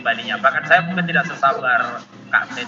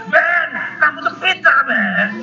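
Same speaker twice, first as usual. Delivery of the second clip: put-on high, louder encouraging shout to a child; retold shout; 9 semitones higher, 3 dB louder, slower than usual. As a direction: call out praise loudly, like a parent. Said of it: "Jadi saya teriak". (teriakan orang tua yang memuji anak)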